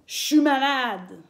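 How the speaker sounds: "Je suis malade" as "chu malade", slowly; Québec chu. Shown as a demonstration. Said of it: "au lieu de dire je suis malade".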